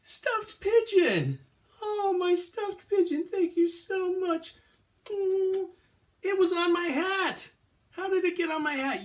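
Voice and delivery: very high, loud, drawn-out squeal of joy; hammed-up ecstatic reunion cry; overjoyed. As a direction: gush in a squeaky, delighted voice. Finds lost stuffed pigeon, overjoyed.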